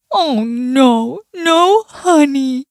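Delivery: lightly voiced dismayed bear; drawn-out, disappointed storybook bear. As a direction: let the bear's dismay stretch the words. Bear finds cupboard empty.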